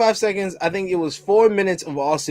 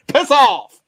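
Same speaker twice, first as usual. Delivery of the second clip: sudden higher, louder snap; Austin's piss off; 6 semitones higher, 4 dB louder than usual. Retold or imitated. Austin telling a heckler off mid-promo, sudden louder snap. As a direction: bark it at a heckler. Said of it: "under his breath".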